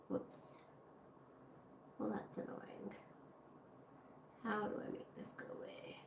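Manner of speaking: whispered grumble at the screen; annoyed whisper to self. Real, not acted. Streamer whispering at a pop-up blocking her screen. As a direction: breathe it to yourself, irritated.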